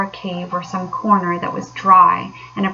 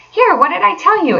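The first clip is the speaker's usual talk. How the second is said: much higher triumphant exclaim; in great triumph; 11 semitones higher, 4 dB louder than usual. A narrator's reading. Rat showing the Mole the doormat, triumphant. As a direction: crow over the find. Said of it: "exclaimed the Rat in great triumph".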